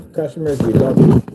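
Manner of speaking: loud, emphatic declaration of love; hearty shout-out. Acted. Vlogger signing off to loyal customers, hearty shout-out. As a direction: call it out proudly to the fans. Sign-off contrasting customers with haters.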